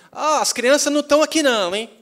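high, loud imitated holler; acted shout to warn someone off; shouting from afar. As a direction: yell it across the street as the old lady. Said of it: "ela já gritou de lá".

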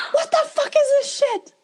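high-pitched mock outrage as the mother; acted high, indignant scream; screaming mom. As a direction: shriek it like an appalled mother. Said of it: "maybe her mom is screaming about something else".

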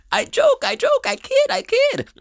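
very high, loud squeaky voice; squeaky joke voice. Pretend. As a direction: squeal it out playfully.

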